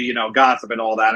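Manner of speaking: ordinary delivery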